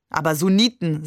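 plain reading voice

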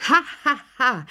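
high, loud mock laughter "Ha, ha, ha"; a burst of careless laughter; loud laughing. A narrator's reading. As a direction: laugh out too loudly, carelessly. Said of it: "lachten die beiden Heloten unvorsichtigerweise zu laut".

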